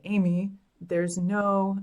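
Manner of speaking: very slow, stretched-out dad impression; imitating her dad. Daughter mimics her retired gynecologist dad's joke reply, very slowly. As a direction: drag the words out like her father. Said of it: "and he talks like this too".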